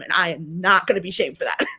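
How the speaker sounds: usual speaking voice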